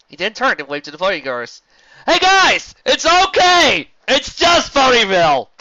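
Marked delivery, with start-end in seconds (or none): 2.1-5.5 high, loud, slow relieved shout; calling out. Acted. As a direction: shout across the crowd. Stallion calling out to crowd.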